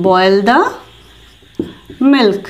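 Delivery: words spaced with long pauses; teacher pacing; slow demo. Shown as a demonstration. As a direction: dictate it slowly. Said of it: "তো এটার জন্য বলবো".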